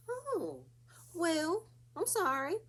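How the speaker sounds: high, sing-song fake sorry; a high sweet apology with a shrug in it; mock apologetic host. Recapper mimics the host apologizing to guests in a high voice.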